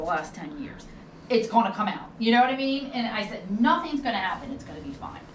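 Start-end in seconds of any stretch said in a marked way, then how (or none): none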